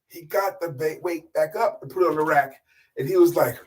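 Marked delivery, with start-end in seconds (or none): none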